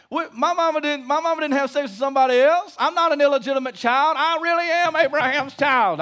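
high, loud offended outcry; hammed-up wounded denial; mock indignant protest. Crowd insisting they are Abraham's children, mock indignant protest. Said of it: "I can hear them saying it now".

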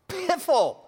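high, loud scornful exclamation; mock scoffing piffle. Preacher voicing Peter dismissing DNA excuses, loud mock scoff. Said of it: "says Peter".

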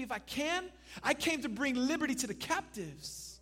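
high-pitched incredulous echo, then emphatic declaration; indignant retort. Acted. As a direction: answer doubt with your mission. Jesus answers doubt with indignant assertion.

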